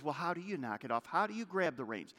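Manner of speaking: ordinary talk, nothing marked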